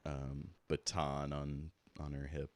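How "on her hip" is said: ordinary talk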